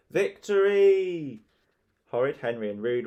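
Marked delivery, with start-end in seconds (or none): 0.1-1.4 loud, high, drawn-out cheer; stretched victorious shout; triumphant cry. A narrator's reading. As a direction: crow it out in triumph. Boys celebrate their successful attack.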